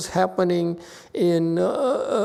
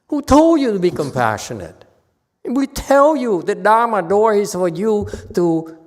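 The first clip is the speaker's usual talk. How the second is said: loud rebuke; 3 semitones higher, 7 dB louder than usual. Real, not acted. Master rebuking false thinking.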